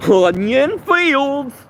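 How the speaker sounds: very high, slow, goofy voice; goofy high squeal, slow and drawn-out; high-pitched funny voice. Shown as a demonstration. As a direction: stretch it out way up high and silly. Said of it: "Let's see if I can do it".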